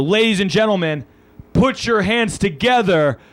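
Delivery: drawn-out, high shouted hype intro; big announcer introduction. Real, not acted. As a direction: build up to the guest's name and shout it. Host announcing the guest on stage.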